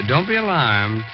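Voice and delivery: ordinary talk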